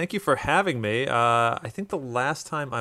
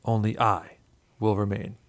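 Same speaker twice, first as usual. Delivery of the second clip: dramatic recital; 4 semitones lower than usual. Acted. Litany recital over intro music.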